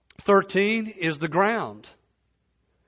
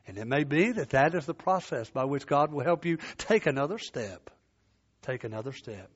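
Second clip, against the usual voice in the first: gentle urging; 5 semitones lower, 5 dB quieter than usual. Real, not acted. Gentle urging to keep going.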